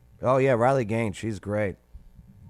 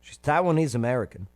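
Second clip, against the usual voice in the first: normal talking voice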